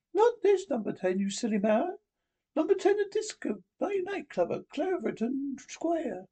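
high put-on Iron Lady voice, pitched well up; Thatcher voice. Prime Minister chiding the Doctor, in a high put-on Thatcher voice.

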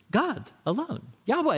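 high, scornful answer; a hammed-up pious scold; mock scribe retort. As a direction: toss it off smugly.

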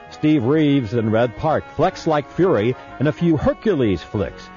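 punchy pitchman ad read; infomercial. Acted. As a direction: sell it like a late-night TV deal. Recorded movie-collection ad read.

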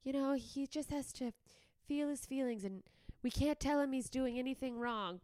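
mock a gentle-parenting mother; permissive mother. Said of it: "that mother that's like".

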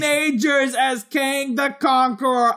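high, slow, booming all-caps read; shouting capitalized words. Acted. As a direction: announce it like a hype man. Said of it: "you can read it in all caps".

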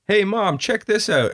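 put-on high-pitched kid calling to mom; eager kid. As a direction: imitate a child showing off.

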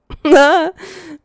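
loud sudden laugh; burst of laughter. Real, not acted. Host laughing at doing it for herself, loud laugh. Laughing after breathing exercise.

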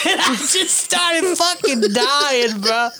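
slow words breaking into laughter; can barely get it out for laughing. Real, not acted. Laughing hard at the memory.

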